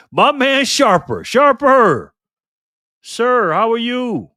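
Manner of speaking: high, loud and slow, calling out a friend's name; excited greeting. Real, not acted. Greeting a returning chat regular.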